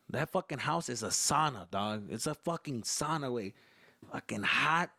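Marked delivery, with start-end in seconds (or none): none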